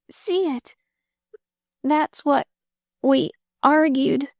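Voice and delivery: high, weak voice growing fainter; fading girl. Acted. Dying girl explains the quarrel, fading. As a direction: let it fade as strength goes. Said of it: "Her voice grew fainter again".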